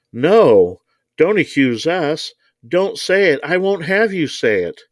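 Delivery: high, panicky protest, read aloud; terrified cry. A narrator's reading. Mister Moen begs not to be accused, in terror. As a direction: plead in alarm, pitched well up. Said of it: "cried Mister Moen in terror".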